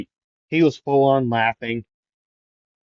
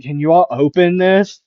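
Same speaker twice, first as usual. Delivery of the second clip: loud, higher plea with rising end; raised voice, pitched-up question; calling out; 7 semitones higher, 8 dB louder than usual. Acted. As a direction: call through thick glass to be let in. Character calling through the glass.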